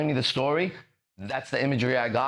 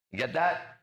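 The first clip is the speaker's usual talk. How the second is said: normal talking voice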